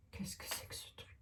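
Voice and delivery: puzzled whisper to herself; hushed, wondering whisper. Real, not acted. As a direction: breathe it out, baffled by the card. Puzzled by a tarot card.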